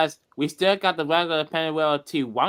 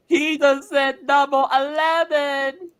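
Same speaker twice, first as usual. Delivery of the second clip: shocked gasp; 9 semitones higher, slower than usual. Real, not acted. Gasping at the swear.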